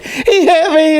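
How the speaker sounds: high hammed-up cry through a laugh; mock victim wail. [name] rolling down the car's side, laughing high mock wail. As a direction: squeal it like a fake accident victim, laughing.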